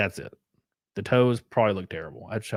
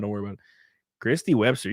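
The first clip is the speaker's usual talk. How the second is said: normal talking voice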